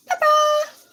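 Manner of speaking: high, loud cry of pepper; loud high shout of discovery; excited call. Real, not acted. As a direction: sing it out like finding treasure. Calling out the forgotten pepper.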